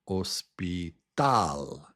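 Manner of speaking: slow Spanish "hospital" as os, pi, TAL; "hospital" with no h, landing hard on TAL; stress on syllable three. Shown as a demonstration. Teacher shows Spanish stress, "hospital" slowly with strong last TAL. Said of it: "number three".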